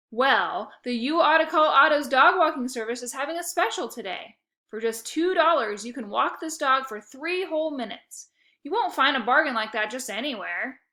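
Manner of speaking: loud, pushy sales patter; huckster pitch. A narrator's reading. Otto advertises his dog walking special to Fisher. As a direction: hype it like an ad.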